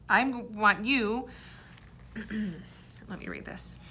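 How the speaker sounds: normal talking voice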